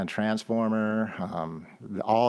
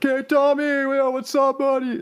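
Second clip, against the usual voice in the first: high, loud put-on Southern country accent; fake Southern twang; 6 dB louder than usual. Mocking a friend's fake country twang, hammed-up Southern accent. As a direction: ham up a Southern twang greeting a buddy. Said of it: "singing with fake country accents".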